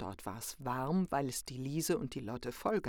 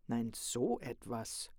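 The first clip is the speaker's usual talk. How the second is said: wondering; 3 semitones lower, slower than usual. A narrator's reading. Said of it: "wunderte sich der Großvater".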